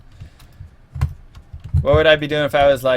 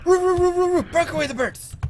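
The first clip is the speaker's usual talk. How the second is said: mock dog bark; 12 semitones higher, faster than usual. Barking again, softer.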